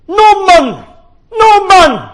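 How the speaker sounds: high, loud screamed name across the platform; screaming name. Acted. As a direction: scream it from far away. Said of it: "someone screaming".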